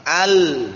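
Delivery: loud "Al" with a vowelless, sounded l; sukun on lam. Shown as a demonstration. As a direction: stop firmly on the l of "Al". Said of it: "dibaca sukunnya di atas lam".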